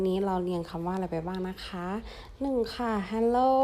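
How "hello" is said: a teacher drawing out Hello to repeat; slow model word. Shown as a demonstration. Teacher models a slow Hello for pupils to repeat. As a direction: say Hello slowly and clearly for the class. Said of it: "ออกเสียงตามค่ะ".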